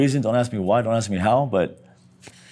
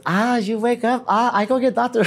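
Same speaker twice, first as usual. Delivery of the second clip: high, broken-English nurse voice; nurse impression; 11 semitones higher than usual. Nurse telling the patient she'll fetch the doctor, mock accent. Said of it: "Here comes the nurse".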